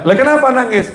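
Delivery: high-pitched puzzled wife; istri heran. Acted. Istri heran bertanya kepada suaminya. (menirukan istri yang heran, nada tinggi)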